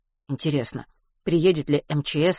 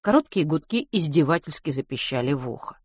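ordinary delivery

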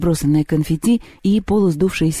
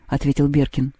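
ordinary delivery, nothing marked

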